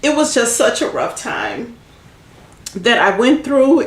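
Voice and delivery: struggling not to cry; getting emotional. Real, not acted. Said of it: "I'm sorry, I'm getting emotional".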